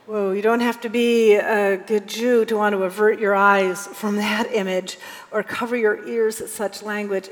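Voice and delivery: ordinary talk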